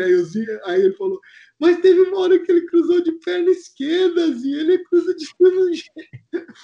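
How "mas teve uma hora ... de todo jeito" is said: high, louder, tearful whine; mock crying complaint. Júnior complaining to Zinho about crosses, high crying whine. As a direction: sob the complaint, pitch way up. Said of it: "o Júnior chorando".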